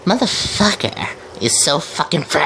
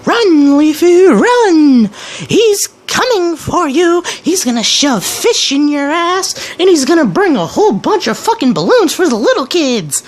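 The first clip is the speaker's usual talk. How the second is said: high, loud, hammed-up alarm; mock cheering; 14 semitones higher, 3 dB louder than usual. Mocking a video bully, warning Leafy in hammed-up excitement. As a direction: cheer him on in a sarcastic panic.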